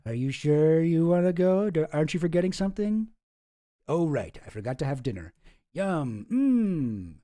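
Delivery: sinister host. Acted. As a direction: tease him like a smug villain. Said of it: "my little one-act play there of starring those two mysterious guys".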